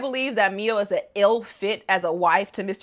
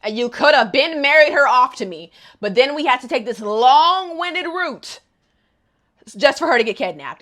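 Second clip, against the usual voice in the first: angry; 3 semitones higher, 6 dB louder than usual. Retold or imitated. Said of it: "Koji was just like".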